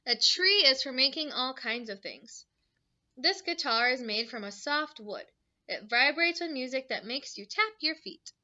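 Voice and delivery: bright storybook read-aloud; reading to children. Acted. Teacher reading a book to her class, bright and lively.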